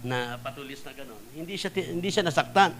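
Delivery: usual speaking voice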